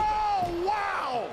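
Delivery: sudden loud gasp of amazement; excited shout. Real, not acted. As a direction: shout it out in awe. Commentator reacts to big move.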